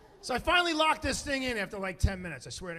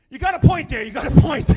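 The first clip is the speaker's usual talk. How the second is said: very loud, dad conceding at once; emphatic conceding; 13 dB louder than usual. Retold or imitated. Dad answers his son's scolding, loudly conceding. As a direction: blurt it out forcefully, no hesitation.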